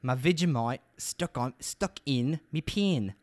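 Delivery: exaggerated Australian accent, "pen" as "peen"; bad Australian accent. Shown as a demonstration. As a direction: repeat it slowly in a clumsy Australian accent. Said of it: "It's just a bad accent".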